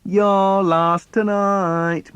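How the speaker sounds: put-on big brother gloats that the boy lost; taunting brother. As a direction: draw out the taunt like a gloating kid. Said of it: "my brother says".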